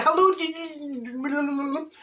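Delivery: a sing-song hello that bluffs a forgotten name; high fake cheery hello. Greeting a stranger whose name she forgot, in a high fake cheery voice. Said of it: "and I'm like".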